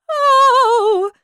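high, loud drawn-out "oh"; pained cry. Acted. Queen pricks her finger on the brooch, pained cry.

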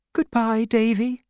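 high-pitched woman's voice; Davy's mother, subdued farewell. A narrator's reading. Mother sees Davy off to school, in a high voice.

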